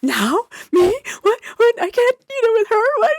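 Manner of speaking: high, loud, flustered mock disbelief; panicked protest. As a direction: play a rookie doctor balking in panic. Acts out panicked young doctor.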